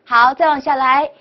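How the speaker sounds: ordinary talk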